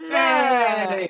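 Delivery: loud, drawn-out high yay; cheering. Real, not acted. Cheering the friend's bag, drawn-out and loud.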